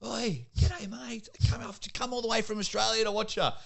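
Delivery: high, hammed-up Australian accent; cheeky Australian accent hailing the ballplayers; played-up Australian accent. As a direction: lay the Australian accent on thick, calling across the field. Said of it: "play on the Australian accent a bit".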